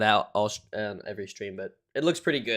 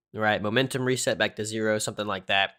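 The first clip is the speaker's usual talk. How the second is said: usual speaking voice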